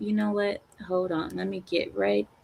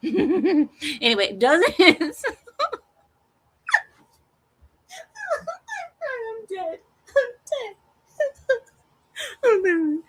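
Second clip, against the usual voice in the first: high, slow words breaking into laughter; laughing fit; 13 semitones higher, slower than usual. Real, not acted. Laughing fit over naughty word.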